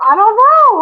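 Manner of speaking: a sudden squeaky high pitch, mock helpless; squeaky high I don't know. Squeaky mock-clueless reply to her own question. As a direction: squeal it like a cartoon kid.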